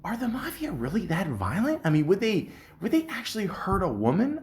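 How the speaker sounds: lightly voiced lady asking about danger; imitating the lady. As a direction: mimic her asking, worried and high. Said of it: "she's like".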